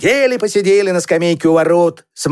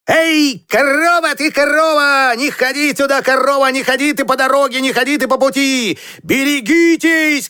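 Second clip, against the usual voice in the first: high, urgent calls at a cow; high-pitched storybook yelling; boy shouting; 6 semitones higher than usual. A narrator's reading. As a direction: shoo the cow off the road in a high voice. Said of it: "крикнул Мишка".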